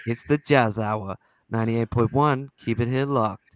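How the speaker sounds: low midnight DJ voice; put-on smooth, low radio patter; Chase Love. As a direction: croon it like a late-night jazz radio host. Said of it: "D J voice".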